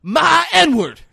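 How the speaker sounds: very high, loud, whooping excitement; pumped-up Denzel. Retold or imitated. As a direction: crow to the whole room, hugely pumped. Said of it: "He's so pumped".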